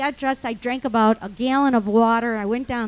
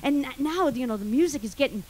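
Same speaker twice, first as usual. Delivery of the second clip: ordinary delivery, nothing marked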